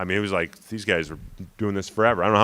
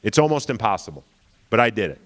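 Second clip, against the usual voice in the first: ordinary delivery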